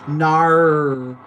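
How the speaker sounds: loud, stretched Aussie "Nar"; Australian accent. Acted. Repeating the mermaid show line, loud Australian accent. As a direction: belt out an exaggerated Australian "no". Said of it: "in an Aussie accent is".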